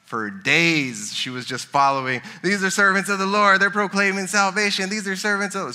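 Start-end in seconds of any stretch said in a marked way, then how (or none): 2.4-5.7 put-on higher, louder nagging chant of the girl; mock shouting girl. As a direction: repeat it like an annoying girl calling after them. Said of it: "shouting these things for days".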